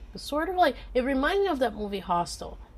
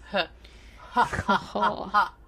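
breathy villain cackle, half in jest; evil laugh; slower than usual. Pretend. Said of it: "That's my evil laugh".

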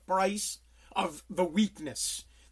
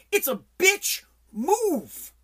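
choked-up, slow, high crack of anger; 10 semitones higher, 4 dB louder, much slower than usual. Real, not acted. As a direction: voice breaking with emotion on bitch move. Angry rant about abandonment.

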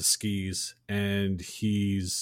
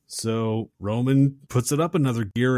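ordinary talk

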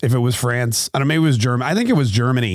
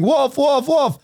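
very high-pitched mock shout of alarm; mock cry for help; 12 semitones higher, slower than usual. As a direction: cry wolf in drawn-out high calls. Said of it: "the boy was crying".

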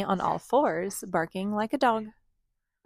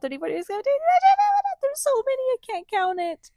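building frantic; 4 dB louder, much faster than usual. Real, not acted. Mock overwhelmed counting.

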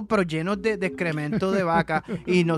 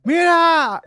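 shouted call, much higher and louder; 10 semitones higher, 9 dB louder than usual. Retold or imitated. Passing driver calls to the boys, loud shout. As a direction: bellow it across the road.

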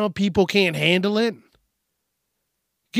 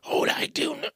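much higher, softer creature rasp; failed Gollum; 10 semitones higher, 5 dB quieter than usual. Acted. Trying the creature voice again, softer and higher. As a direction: try the Gollum voice and miss. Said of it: "that's not even Gollum".

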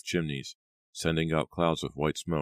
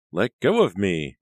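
loud, slow, furious protest; angry cry; 4 semitones higher, 8 dB louder than usual. Acted. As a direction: shout at him to let go. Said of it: "Riva cried angrily".

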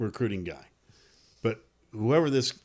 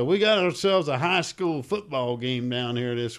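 put-on Texas accent; 2 semitones higher than usual. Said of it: "I'm doing a Texas accent".

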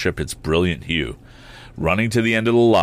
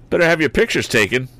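high, fast taunting cry; mocking cry; 8 semitones higher, 4 dB louder, faster than usual. A narrator's reading. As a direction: taunt them from the window. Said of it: "cried Ned".

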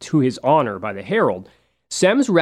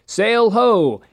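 mild mock yell, raised pitch; imitated lookout's yell; 8 semitones higher, 3 dB louder than usual. Lookout alerting the ship to a spotted target, mock yell. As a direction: call it out higher, like a restrained lookout's cry. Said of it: "the lookout would yell".

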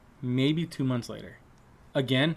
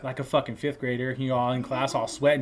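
ordinary talk

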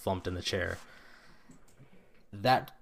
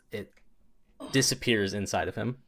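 ordinary talk

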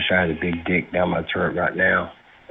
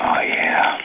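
whispering. Acted. Obscene caller moaning.

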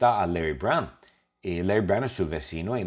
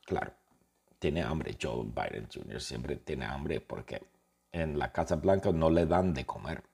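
ordinary talk, nothing marked

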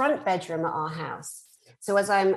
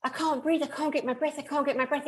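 high, breathless panic; panicked gasping; 7 semitones higher, faster than usual. Retold or imitated. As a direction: gasp it out like you can't breathe. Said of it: "I thought".

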